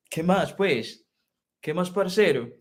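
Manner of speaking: casual Colombian Paisa greeting; Paisa accent. Shown as a demonstration. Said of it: "Paisa accent". (demonstrated Paisa Spanish accent)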